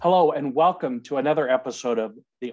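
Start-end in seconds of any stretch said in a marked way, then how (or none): none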